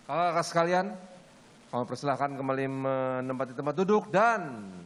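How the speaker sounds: normal talking voice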